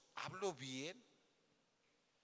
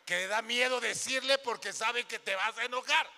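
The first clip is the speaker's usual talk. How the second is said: loud excited aside; 7 semitones higher, 12 dB louder than usual. Acted. Preacher voicing scared husband.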